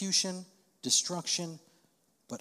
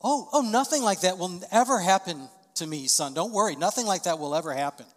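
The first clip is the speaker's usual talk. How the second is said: false reassurance; 5 semitones higher, 9 dB louder than usual. Shown as a demonstration. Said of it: "What you don't wanna say is".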